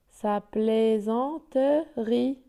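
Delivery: "plaisanterie" slowly split as plai-zan-te-rie; syllable by syllable. Shown as a demonstration. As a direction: voice the s as z in slow "plaisanterie". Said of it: "donc il se prononce Z". (« plaisanterie » lentement découpé, le s prononcé z)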